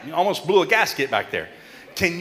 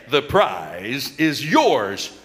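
slow cue phrase; 6 semitones lower, much slower than usual. Shown as a demonstration. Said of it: "When I say".

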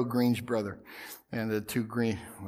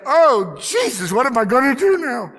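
high, loud panicked gasp of a prayer; horrified cry; 8 semitones higher, 8 dB louder than usual. Retold or imitated. Pastor horrified at the dropped Speedos, high loud panic. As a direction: cry out in horror at the sight. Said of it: "looked at that in absolute horror".

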